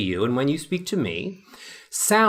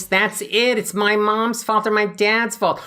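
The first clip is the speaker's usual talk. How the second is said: whiny nasal complaint; 5 semitones higher than usual. Shown as a demonstration. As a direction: push it up into the nose. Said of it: "a really nasal voice".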